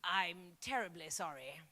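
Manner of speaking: low, soft terribly sorry; mock posh Brit apologising; understated stiff English politeness; polite English apology. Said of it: "Or when they say".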